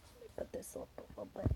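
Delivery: fast hushed whisper aside. Real, not acted. Whispering aside while reading.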